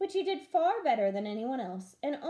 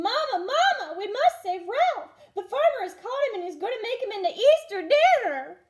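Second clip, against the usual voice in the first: high, loud call for help; 12 semitones higher, 9 dB louder than usual. Acted. Liza calls to Mama while running to save Ralph. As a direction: call ahead as she runs, urgent.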